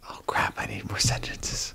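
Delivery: hushed whisper of dismay; whispered aside. Real, not acted. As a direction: whisper it to yourself as you realize. Running out of allowed sentences.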